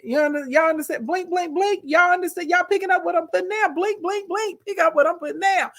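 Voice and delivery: high-pitched, pointed nudge to the listener; knowing hint. Shown as a demonstration. Host nudging viewers to read between the judge's words, knowing hint. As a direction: drop a playful, high-voiced heavy hint. Hinting at hidden meaning to viewers.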